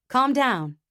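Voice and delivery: firm, loud command. A narrator's reading. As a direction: say it firmly. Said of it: "Jake says firmly".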